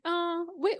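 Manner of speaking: high-pitched tentative "oh"; hesitant volunteering. Acted. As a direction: offer it reluctantly, pitch sliding high.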